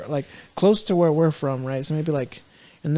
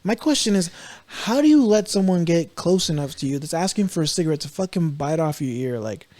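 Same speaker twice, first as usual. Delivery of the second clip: ordinary delivery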